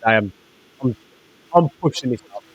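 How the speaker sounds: muffled voice, as if through a hand. Real, not acted. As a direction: talk with a hand over your mouth. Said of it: "Sorry, you're a bit muffled".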